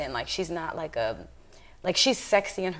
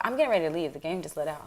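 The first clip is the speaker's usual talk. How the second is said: usual speaking voice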